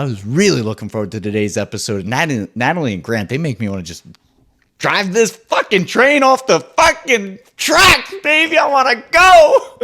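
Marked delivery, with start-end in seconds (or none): 4.8-9.8 much higher, louder, slow hyped shout; riled-up shout. Real, not acted. Fired up about Natalie and Grant, wild excited shout with a laugh. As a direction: build into a wild hyped yell.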